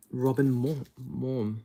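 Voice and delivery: ordinary delivery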